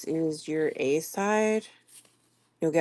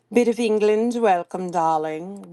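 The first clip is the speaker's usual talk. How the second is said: English accent; 3 semitones higher than usual. Real, not acted. Host slips into English while welcoming a viewer, English accent. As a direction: slip lightly into an English accent. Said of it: "didn't mean to do the accent".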